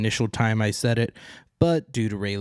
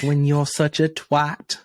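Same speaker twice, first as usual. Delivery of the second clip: [name] line; 6 semitones higher, slower than usual. Retold or imitated. Quoting a favourite British singer, slow mock lilt. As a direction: savour it like a British rock singer. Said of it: "And he's like".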